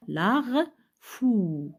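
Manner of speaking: "Lar", "fou" loud and apart; syllable by syllable. Shown as a demonstration. Teacher showing two syllables to order, "Lar", "fou" said one by one. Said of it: "se compose de deux syllabes".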